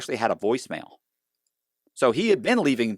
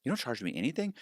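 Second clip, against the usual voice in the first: softer, higher questioning echo; mildly surprised customer; 4 semitones higher, 10 dB quieter than usual. Retold or imitated. Client answering the broker's offer, mildly surprised. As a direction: lightly mimic a client's hopeful disbelief. Customer replies in acted exchange.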